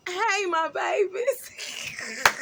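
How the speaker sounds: high, very slow sing-song hello to the viewers; sweet greeting switch. Acted. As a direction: flip instantly to a sugary welcome. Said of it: "You see how I just flipped to that?".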